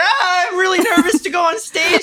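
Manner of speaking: high put-on cartoon voice; mock-nervous high cartoon whine; Rick and Morty impression. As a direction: squeal the nerves out in a cartoon pitch. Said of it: "I'd be like".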